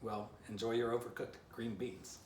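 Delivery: plain reading voice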